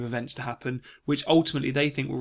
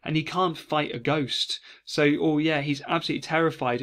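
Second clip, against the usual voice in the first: ordinary talk, nothing marked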